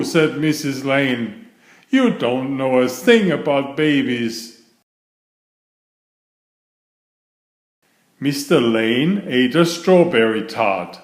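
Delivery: lively read-aloud for children, voicing each character; story narration. A narrator's reading. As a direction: read it like a bedtime picture book. Reads picture book aloud.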